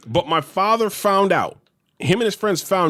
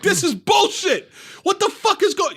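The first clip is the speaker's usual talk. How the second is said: mock outrage, pitched way up; losing his shit; 12 semitones higher than usual. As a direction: imitate a father ranting at a rigged game. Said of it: "My father started losing his shit".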